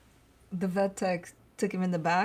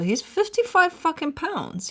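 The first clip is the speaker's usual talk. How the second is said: exasperated emphasis; 8 semitones higher, 6 dB louder than usual. Real, not acted. Exasperated about big dog.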